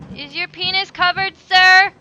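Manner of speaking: loud, high mock-polite question; joking formal inquiry; mock concern. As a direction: tease the body like a butler. Addressing corpse mockingly.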